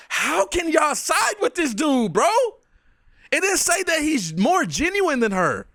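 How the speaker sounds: high-pitched, incredulous rant; real exasperated outburst; exasperated disbelief. Real, not acted. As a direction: demand an answer, pitch riding high in disbelief. Ranting in disbelief at clip.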